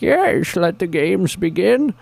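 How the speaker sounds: high put-on villain voice; Bane impression. Answering the hero in radio commercial, mock villain voice. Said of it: "And I'm like".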